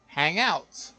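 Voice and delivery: normal talking voice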